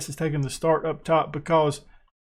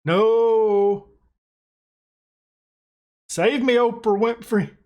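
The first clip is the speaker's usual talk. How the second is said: half-joking despair at the attack; mock desperate plea; 5 semitones higher, much slower than usual. Real, not acted. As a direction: beg to be saved.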